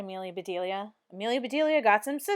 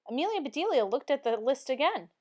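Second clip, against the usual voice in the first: usual speaking voice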